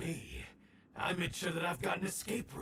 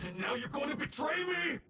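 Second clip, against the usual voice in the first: higher and shouted, indignant; outraged shout; 7 semitones higher than usual. Acted. Villain accusing his partner of betrayal, outraged shout. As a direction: fling the accusation at him.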